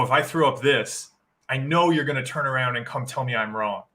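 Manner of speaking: ordinary talk, nothing marked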